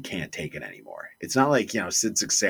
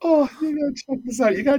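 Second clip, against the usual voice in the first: higher, excited; excited recommendation; 4 dB louder, faster than usual. Real, not acted. Friend excitedly urging him to watch the clip. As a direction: urge them eagerly. Teller still laughing.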